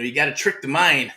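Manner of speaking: ordinary talk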